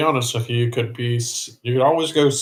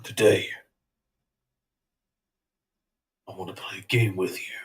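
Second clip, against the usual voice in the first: low, hushed horror puppet voice; lightly voiced creepy puppet; Jigsaw voice; 4 semitones lower, 6 dB quieter than usual. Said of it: "my best impression of Jigsaw".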